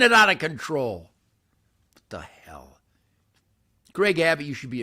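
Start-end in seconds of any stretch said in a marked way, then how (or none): 1.9-2.8 hushed, breathless whisper of disbelief; whispered what the hell. Real, not acted. Ranting about property taxes, a sudden whispered aside. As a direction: drop to a stunned whisper.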